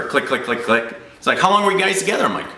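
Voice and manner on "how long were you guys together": high-pitched curious question; prying agent. Retold or imitated. Insurance agent asking customer about his relationship, high-pitched curiosity. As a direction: imitate an agent itching to know, pitched way up.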